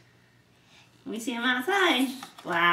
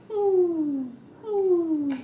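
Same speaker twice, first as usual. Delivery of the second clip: high, falling hoots; long hooting oohs, sliding down; owl hoot; 7 semitones higher than usual. Acted. Parent showing what an owl says, high hooting. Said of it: "And it says".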